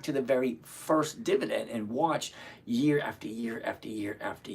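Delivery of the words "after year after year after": whisper. Real, not acted. Counting years slipping off.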